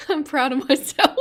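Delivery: laughing. Real, not acted. Laughs at own freeze frame.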